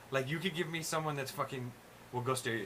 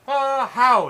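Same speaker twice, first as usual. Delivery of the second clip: big, loud caricature greeting, pitched way up; 11 semitones higher, 18 dB louder than usual. Retold or imitated. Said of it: "he didn't come in here like".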